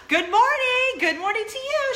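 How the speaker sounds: over-excited high-pitched greeting; gushing greeting. Retold or imitated. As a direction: burst out like meeting a celebrity. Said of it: "I'm like, gasps".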